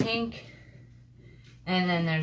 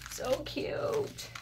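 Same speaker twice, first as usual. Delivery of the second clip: breathless high whisper "So cute"; 8 semitones higher than usual. Real, not acted. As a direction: whisper it in delight. Delighted by craft gifts.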